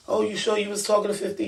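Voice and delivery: doubting question. Retold or imitated. Echoing the doubters who questioned the fifty claim, fast, high disbelief. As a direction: toss it off like the doubters did. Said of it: "And everybody say to me".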